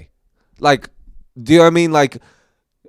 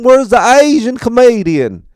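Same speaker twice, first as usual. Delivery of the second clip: loud, high Southern holler with broad vowels; drunk Southern accent; 14 semitones higher, 10 dB louder than usual. Retold or imitated. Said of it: "because of his accent".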